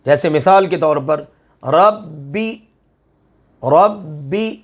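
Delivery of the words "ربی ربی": ربی with the doubled ب held firm, a short stop on it; tajweed recitation. Shown as a demonstration. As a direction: recite ربی pressing the shadda. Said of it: "سختی سے ذرا رک کر اس کو پڑھ لیں". (ربی میں ب پر شد، سختی سے رک کر)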